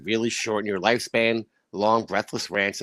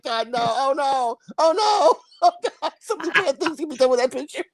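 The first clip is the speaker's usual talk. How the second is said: high, loud horrified laughing; cracking up in disbelief; 4 dB louder, slower than usual. Real, not acted.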